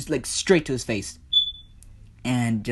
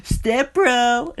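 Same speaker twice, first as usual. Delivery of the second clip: high, loud, joking call; hammed-up squeal played for laughs; mock stepbrother cry; 12 semitones higher, 6 dB louder than usual. Storyteller mocks the stepbrothers joke, high hammed-up cry.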